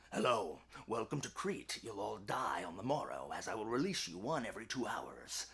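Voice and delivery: very low, hushed villain welcome; quiet menacing host, deep and unhurried; sinister King Minos. Retold or imitated. Voicing Minos greeting the doomed.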